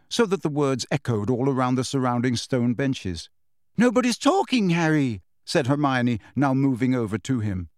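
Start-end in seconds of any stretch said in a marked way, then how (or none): 3.8-5.2 alarmed insistence. A narrator's reading.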